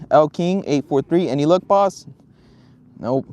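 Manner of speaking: usual speaking voice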